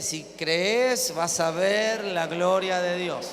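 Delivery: slow, deliberate words for repeating; slow declaration. Shown as a demonstration. Pastor modelling what to tell a neighbour, slow. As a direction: model the phrase slowly. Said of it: "Agárrale la mano ... decirle".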